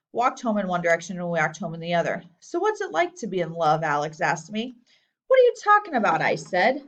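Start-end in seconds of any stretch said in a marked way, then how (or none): 5.3-6.2 indignant denial. A narrator's reading. Boy denies being in love.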